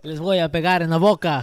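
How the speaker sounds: loud, mocking show-off Spanish; loud quoted Spanish line. Quoting the show character's forced Spanish line, loudly.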